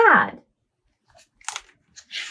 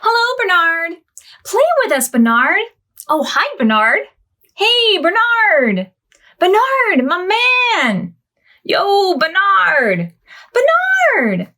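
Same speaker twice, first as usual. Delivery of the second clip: a string of high, playful critter voices; animal friends greeting; 9 semitones higher, slower than usual. Acted. Friendly animals calling out to Bernard, playful voices. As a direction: give each animal its own silly voice.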